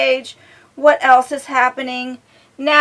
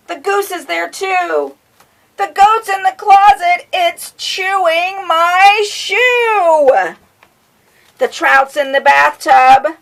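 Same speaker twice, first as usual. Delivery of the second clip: higher, louder, building frazzled rhyme; dramatic read-aloud; 4 semitones higher, 7 dB louder, slower than usual. A narrator's reading. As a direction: read it like everything's going wrong.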